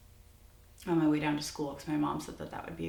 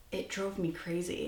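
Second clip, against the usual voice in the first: ordinary talk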